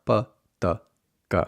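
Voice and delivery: p, t, k with no puff of air; crisp Spanish p, t, k, no breath after; unaspirated Spanish p, t, k. Shown as a demonstration. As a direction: say p, t, k muted, without aspiration. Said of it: "sounds to work on".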